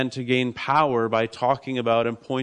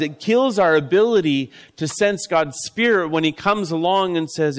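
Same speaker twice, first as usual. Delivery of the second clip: loud, rising sermon build; preaching at full force; 4 semitones higher, 5 dB louder than usual. Acted. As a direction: drive it home like a preacher.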